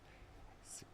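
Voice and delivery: breathy unvoiced "hey Nova"; whispering. Shown as a demonstration. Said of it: "I have to whisper it".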